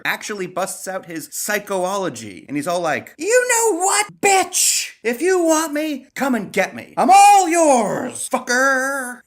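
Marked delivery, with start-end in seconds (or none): 3.2-9.2 high, loud, drawn-out taunting; mock-heroic shouted dare; hammed-up defiant yell. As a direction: shout the challenge with comic bravado. Acts Peter taunting Venom, fed up.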